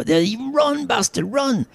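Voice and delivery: an overexcited Scot in the bleachers; excited Scotsman yell. Acted. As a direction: bellow at the runner like a new fan. Scotsman cheering.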